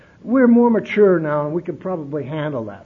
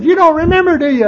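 much higher and louder, scolding; sister scolding; 11 semitones higher, 12 dB louder than usual. Retold or imitated. Sister confronting her brother about his drinking, loud. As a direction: hammer it at him in disbelief.

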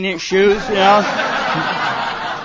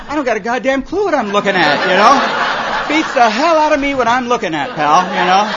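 sarcastic reply; 3 semitones higher, 5 dB louder than usual. Retold or imitated. Answering rude kid.

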